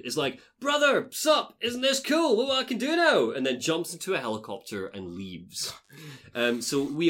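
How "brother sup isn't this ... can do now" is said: gloating Liquid. Acted. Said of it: "speaking as Liquid".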